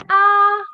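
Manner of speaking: A held long, high, loud and level; flat first tone. Shown as a demonstration. Teacher recapping four tones, A high and flat. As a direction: sustain A on one high note. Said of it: "So four tones".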